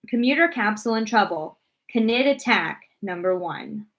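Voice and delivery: ordinary delivery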